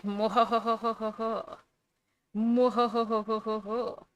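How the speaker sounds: slow villainous mwahaha cackle; mad scientist laugh. Retold or imitated. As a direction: cackle like a cartoon evil mastermind. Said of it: "C I A's like".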